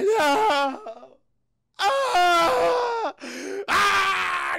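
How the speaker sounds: long, loud high screams of rage; berserker rage scream. Retold or imitated. Wolverine finding her caked in blood, berserk screaming. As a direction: howl in hammed-up berserker fury. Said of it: "He goes berserker rage".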